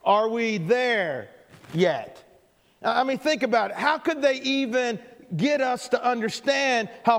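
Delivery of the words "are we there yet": put-on dragged-out kid whine; slow whiny drawl. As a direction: stretch it out like a bored kid. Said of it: "the all-time classic".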